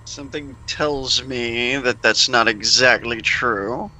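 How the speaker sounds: ordinary talk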